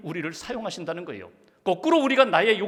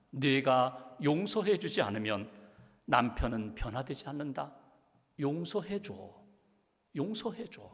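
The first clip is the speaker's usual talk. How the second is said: soft, measured, with pauses; God quietly urging forgiveness; gentle divine voice; 5 semitones lower, 6 dB quieter than usual. Retold or imitated. God's gentle answer to the wife. (하나님 음성처럼 나직하고 부드럽게)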